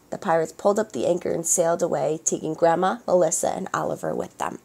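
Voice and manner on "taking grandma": usual speaking voice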